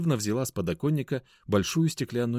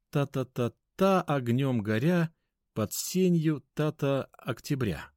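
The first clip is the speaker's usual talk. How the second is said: slow, higher-pitched recital of a half-forgotten verse; припоминает стихи; 6 semitones higher, slower than usual. Acted. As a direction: chant the rhythm slowly, filling gaps with ta-ta. Припоминает забытую строчку стихов. (медленно припоминая стихи нараспев)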